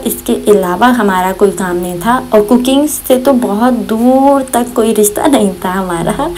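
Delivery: usual speaking voice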